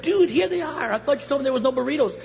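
high, fast, excited complaint; excited protest. Retold or imitated. Customer tells clerk the burritos are here, excited protest.

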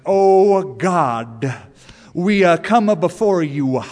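loud southern preacher, lingering endings; lingering prayer voice. Retold or imitated. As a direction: drag out the consonants like a pulpit preacher. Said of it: "a lingering at the end of the consonant".

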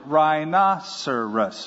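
drawn out slowly; Adam slowly naming the rhino. Retold or imitated. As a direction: say it slow and deliberate. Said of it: "he shortened it".

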